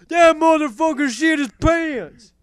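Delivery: high, loud, slow disgusted yell; freaked-out friend. Retold or imitated. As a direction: yell it in horrified disbelief. Friend Jeff freaking out at smell.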